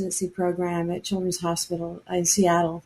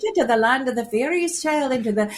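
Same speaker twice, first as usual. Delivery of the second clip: a hammed-up Irish storyteller's lilt; poor Irish accent; 3 semitones higher than usual. Storyteller soothing a girl in pain, in a poor Irish accent. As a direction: soothe a sick child in a put-on Irish brogue. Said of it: "poor Irish accent".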